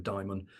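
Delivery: "Daimon" with first syllable like "die"; alternative pronunciation. Shown as a demonstration. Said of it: "tends to be the pronunciation".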